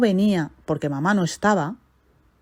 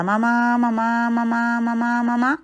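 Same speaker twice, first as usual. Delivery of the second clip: put-on child calling out; 5 semitones higher, slower than usual. Child calls for absent mother.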